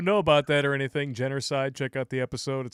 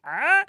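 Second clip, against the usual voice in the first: short, high, loud yelp; mock gasp of surprise; startled "ah"; 12 semitones higher, 6 dB louder than usual. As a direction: voice a goofy startled look. Voices face in photoshopped picture.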